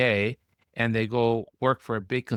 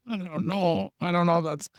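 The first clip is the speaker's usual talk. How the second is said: higher, nervous hedging doctor voice; fretting partners; 6 semitones higher than usual. Retold or imitated. Partners balking at a proposal, higher anxious hedging. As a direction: play the anxious partner stalling, pitched up. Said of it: "you get the partners twisting".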